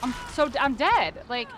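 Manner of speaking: loud shocked outbursts; disbelieving yells. Real, not acted. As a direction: react in total disbelief. Dying in game, exclaiming.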